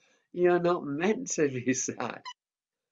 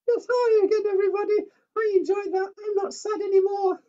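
much higher squeaky puppet; 10 dB louder than usual. Acted. Mickey the puppet cutting in, high-pitched. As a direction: make the puppet pipe up.

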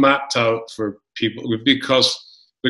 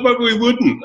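high, flat-out admission; blunt coworkers; 13 semitones higher, slower than usual. Retold or imitated. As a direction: admit it bluntly, no shame. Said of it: "They're like".

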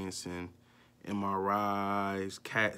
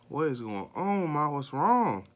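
retold alarm; 6 semitones higher, 5 dB louder than usual. Retold demand to crying mother.

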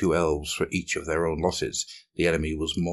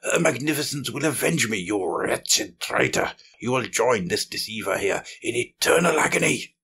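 high-pitched furious shriek; shrieked threat, pitched up high and spat out in fury; Alenzia shrieking; 7 semitones higher, 4 dB louder than usual. A narrator's reading. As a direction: rage defiantly at a traitor. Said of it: "shrieked Alenzia".

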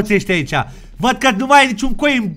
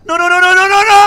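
high frantic no-no-no; panicked cry before torture; panicked pleading; 11 semitones higher, 6 dB louder, much faster than usual. Acted. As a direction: beg in rising terror. Fingernail about to be pulled.

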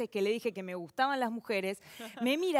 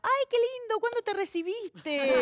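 sweet grandma; 8 semitones higher than usual. Retold or imitated. Grandma misunderstanding, high sweet.

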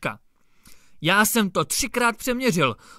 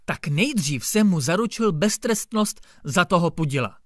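ordinary delivery

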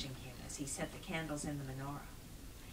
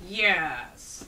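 loud, drawn-out grumbled yes; grudging, grumpy goblin agreement; grumbling goblin; 2 semitones higher, 12 dB louder than usual. A narrator's reading. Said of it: "the goblin grumbled".

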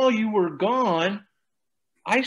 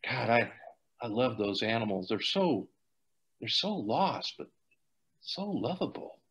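soft and tender; 6 semitones lower, 8 dB quieter than usual. Acted. Gushing over shelter animals.

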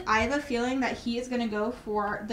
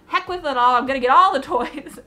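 loud excited outburst; 10 dB louder than usual. Retold or imitated. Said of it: "and I was like".

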